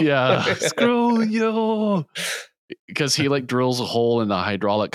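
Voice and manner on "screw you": high, long hammed-up scream; mock drawn-out yell. Co-host quoting the dumbest scene, mock yelling. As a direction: stretch the words into a mock roar.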